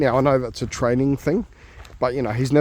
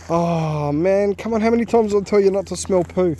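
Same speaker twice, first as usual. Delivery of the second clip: exasperated scolding; 7 semitones higher than usual. Real, not acted. Scolding dog over poo.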